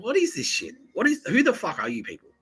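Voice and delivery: high-pitched, incredulous outburst; baffled, indignant retelling; exasperated disbelief. Retold or imitated. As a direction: recount your bewildered outrage, pitch shooting up. Acting own baffled reaction to callers.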